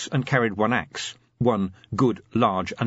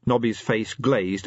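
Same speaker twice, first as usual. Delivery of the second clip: ordinary delivery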